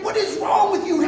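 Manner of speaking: high-pitched appalled reproach; scolding disbelief. Retold or imitated. Wife to cussing husband, mock appalled scolding. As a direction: imitate a wife aghast at her husband.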